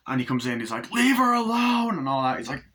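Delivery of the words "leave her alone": much higher and louder, yelling; protective shout. Retold or imitated. Son shouting at father to leave her alone, loud yell.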